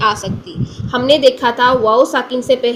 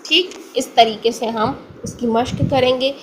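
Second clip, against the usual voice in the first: usual speaking voice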